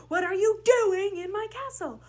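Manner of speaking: "doing" shouted high; stress on doing. Shown as a demonstration. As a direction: belt out "doing" as the peak of the line. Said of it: "pick one word in that sentence to really emphasize".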